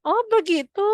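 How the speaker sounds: high, loud "oh, I see" of relief; relieved realisation. Acted. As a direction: act the grandmother suddenly understanding. Grandmother now relieved, acted.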